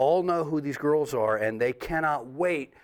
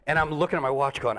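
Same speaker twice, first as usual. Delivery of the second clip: ordinary talk, nothing marked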